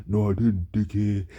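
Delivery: slightly exaggerated copy of a lifeless voice; mocking imitation. Laughing classmate parroting his comeback, mocking imitation. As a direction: mimic his monotone to make fun of it. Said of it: "people made fun of the way I talked".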